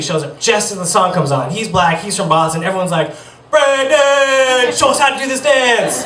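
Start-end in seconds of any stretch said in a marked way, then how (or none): 3.5-6.0 high-pitched mock party-kid shout; excited calling out. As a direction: imitate a crowd of kids eagerly calling out. Kids calling on friend at party.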